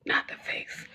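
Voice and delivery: hushed, voiceless little comment; whispered aside. Real, not acted. Host joking to herself on the live, whispered aside. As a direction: whisper it under your breath as a joke.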